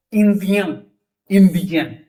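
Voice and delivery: "इंडियन" ending in a nasal ien. Shown as a demonstration. Teacher showing the nasal ending of indien. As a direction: push the ien of "इंडियन" through the nose. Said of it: "नाक से".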